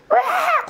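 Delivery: very high, piercing shriek; a startling animal cry, high and harsh; imitated water deer scream. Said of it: "소리가 들리는 거야".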